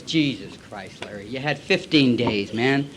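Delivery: low, slow, soft dismay; let-down sponsor. Acted. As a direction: sigh it out as a disappointed sponsor. Voicing sponsor's dismay at relapse.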